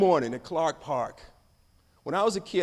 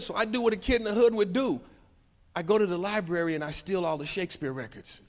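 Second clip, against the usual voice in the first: ordinary delivery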